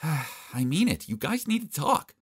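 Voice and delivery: sighing plea. Acted. Vicky pleading with Martin to make peace, slow sighing insistence. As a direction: exhale and then press the point. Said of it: "Vicky sighed".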